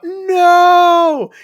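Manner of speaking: high, loud cry of no; yell. Retold or imitated. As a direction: shout it to stop the killer. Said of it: "Finn yells out".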